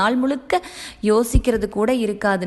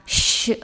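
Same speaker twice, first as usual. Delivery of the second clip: shush. Acted. Husband hushing his wife before the countdown, whispered shush. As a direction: hush her before the surprise.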